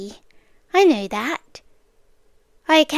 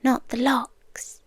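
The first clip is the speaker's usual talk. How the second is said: whispered; 5 dB quieter than usual. Acted. Quiet menacing list.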